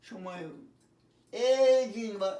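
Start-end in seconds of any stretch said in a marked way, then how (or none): 1.3-2.1 এজিং with a long, louder first vowel. Shown as a demonstration. Teacher showing the long vowel, এজিং drawn out. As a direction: stretch out the opening of এজিং. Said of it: "এটা হচ্ছে long হয়ে গেল".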